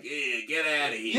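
gruff tough-guy ladybird voice; gruff little tough guy. Retold or imitated. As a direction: bark it like a little street tough. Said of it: "has he got a little gruff voice like".

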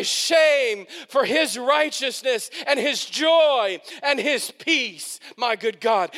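rising pulpit fervour; preaching. Acted. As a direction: preach it to the whole room. Preaching at full force.

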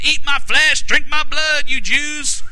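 hammed-up provocative declaration; exaggerated Jesus quote. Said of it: "he didn't say it like that".

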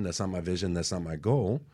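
ordinary talk

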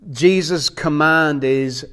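ordinary delivery

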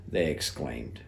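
usual speaking voice

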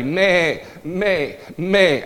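spoken imitation of a sheep; imitated sheep bleat. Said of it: "Sheep can talk to one another".

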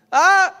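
high loud hammed-up gasp; mock startled yelp. Adam in the garden hiding from God, hammed-up yelp. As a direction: jump like caught naked. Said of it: "We go all Adam in the Garden".

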